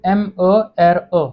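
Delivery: "एम ए आर ए" spelled slowly, letter by letter; slow spelling. Shown as a demonstration. Teacher spelling the French word for mother, slow. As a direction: spell out "एम ए आर ए" deliberately. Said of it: "ये word देखिए".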